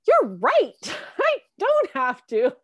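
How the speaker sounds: high, loud burst breaking into laughter; excited realization. Retold or imitated. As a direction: exclaim it like a laughing revelation. Retells her delighted realization to daughter.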